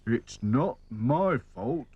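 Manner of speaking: sorry-for-himself doll voice; glum. A narrator's reading. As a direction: drag it out in a sulk. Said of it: "said Sad Sack glumly".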